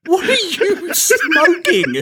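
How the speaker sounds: high, slow, laughing disbelief; incredulous. Real, not acted. Co-host hears Paul Bettany as Wesley, incredulous. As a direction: stretch it out in amused shock. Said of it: "friend reacts to Paul Bettany pick".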